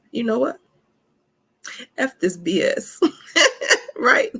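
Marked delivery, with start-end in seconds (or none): 2.0-4.3 laughing through the words, much higher; real laughter, high and giddy. Real, not acted. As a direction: break up laughing as you say it. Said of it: "I'm laughing 'cause".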